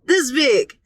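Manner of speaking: normal talking voice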